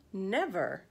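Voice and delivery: ordinary delivery, nothing marked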